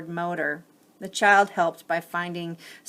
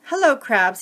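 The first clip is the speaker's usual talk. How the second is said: a bit louder and firmer, less lift; corrected take of Bowie's greeting; 5 semitones higher, 8 dB louder than usual. Acted. Reader redoes Bowie greeting the crabs, firmer take. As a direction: settle the greeting on the retry. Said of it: "let me fix that".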